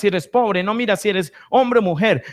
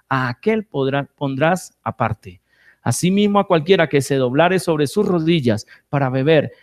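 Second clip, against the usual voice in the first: lower, measured Bible reading voice; scripture reading; 4 semitones lower than usual. Acted. Preacher reading Judges seven to his congregation, measured scripture reading. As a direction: read the verses out steadily to the congregation.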